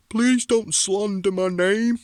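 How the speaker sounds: sneering imitation of his rival begging; mock begging. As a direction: whine it out like a pleading wimp. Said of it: "pal, begging me".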